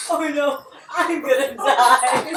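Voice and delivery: laughing hard. Real, not acted. Player cracking up after mishap.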